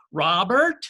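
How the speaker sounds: a warning call up the hall; mom calling again. Retold or imitated. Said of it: "Mom's voice comes again".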